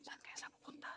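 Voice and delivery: hushed wondering whisper. Real, not acted. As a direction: keep it barely audible. Hiding and watching the woman.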